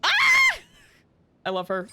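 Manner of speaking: ordinary delivery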